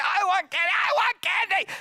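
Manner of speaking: very high, loud screamed demand; child's tantrum. Acted. Child at the supermarket checkout, screaming tantrum. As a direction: shriek it like a kid in meltdown. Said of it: "how to throw a tantrum".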